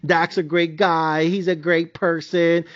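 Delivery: slow, careful mock niceness; lightly voiced diplomatic commentator; polite Tom Brady. Tom Brady sparing feelings on air, slow mock politeness. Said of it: "Tom Brady was trying to be nice".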